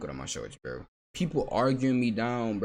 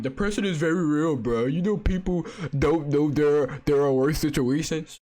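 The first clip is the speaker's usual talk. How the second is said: slow, higher, whiny mock of the comment; mocking a commenter; 5 semitones higher, 5 dB louder than usual. Reading a critic's comment aloud in a slow, mocking whine. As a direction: drag it out like a sneering parody of the commenter. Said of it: "Oh, look at the comment".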